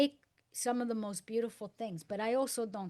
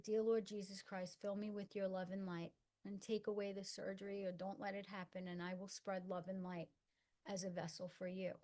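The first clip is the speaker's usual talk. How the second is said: retold prayer; 2 semitones lower, 9 dB quieter than usual. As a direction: recall a private prayer, hushed and softly spoken. Retells her private bedside prayer.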